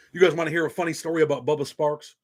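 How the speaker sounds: ordinary talk, nothing marked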